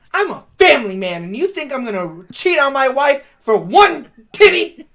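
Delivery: loud, rising mock indignation; indignant. Host acting the tow driver refusing the offer, indignant. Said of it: "He goes".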